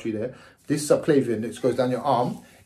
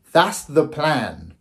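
slow emphatic summary; much slower than usual. Real, not acted. Summing up the surgery plan for viewers, slow and firm. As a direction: lay it down deliberately, word by word.

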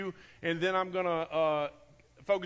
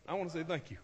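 choked up; 3 semitones lower, 7 dB quieter, faster than usual. Real, not acted. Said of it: "I'm trying not to cry right now".

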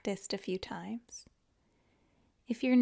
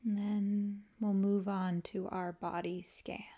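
calm, unhurried yoga nidra guidance with long pauses; guided meditation; 3 dB quieter than usual. Acted. Teacher guiding listeners to let the body melt, calm guided meditation. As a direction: ease them into rest, leaving space after each phrase.

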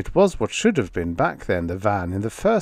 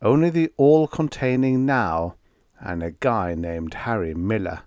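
ordinary delivery

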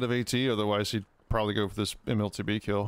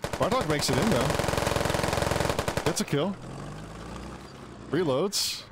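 excited play-by-play; 6 semitones higher than usual. Real, not acted. Caster calls the final kills.

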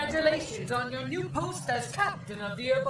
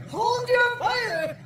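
higher, louder, slow urgent shout; frantic shout; 11 semitones higher, 7 dB louder, much slower than usual. Acted. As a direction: scream the order to stop. Repeated order, more urgent.

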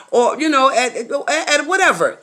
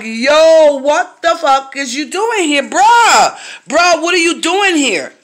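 higher and louder, retold outburst at the ex; shocked yell; 7 semitones higher, 5 dB louder, slower than usual. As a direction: shout it in disbelief at the door. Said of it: "I'm like".

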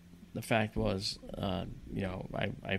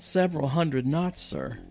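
loud incredulous; 5 semitones higher, 4 dB louder, slower than usual. Retold or imitated. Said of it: "said loudly".

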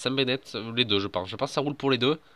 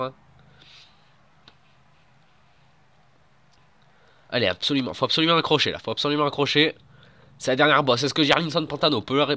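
loud, high finale race call; excited sports commentary; 3 semitones higher, 4 dB louder than usual. Acted. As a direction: build to the final climb. Play-by-play commentary.

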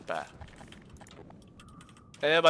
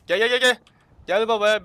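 loud, higher calling out to the enemy; 4 semitones higher, 10 dB louder than usual. Real, not acted. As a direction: yell come on at the monsters. Düşmanı çağırıyor. (düşmana yüksek sesle meydan okuma)

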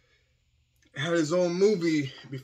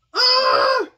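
pained shout; 10 dB louder than usual. Real, not acted. Hot sauce pain.

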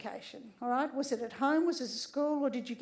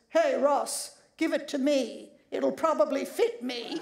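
deep macho voice. Retold or imitated. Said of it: "said in a deep, macho voice".

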